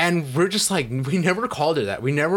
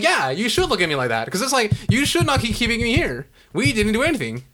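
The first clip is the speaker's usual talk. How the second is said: high, indignant comeback; retold angry retort; 7 semitones higher than usual. Student talking back to the teacher, indignant retold retort. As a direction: argue back, pitch climbing.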